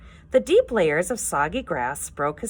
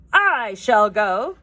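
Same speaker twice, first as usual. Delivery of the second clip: slow, louder, grand heroic offer; noble and dignified; 5 semitones higher, 8 dB louder, slower than usual. Acted. Gobber volunteering to face the monster, noble and dignified. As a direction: puff up and sound noble. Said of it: "trying to sound noble and dignified".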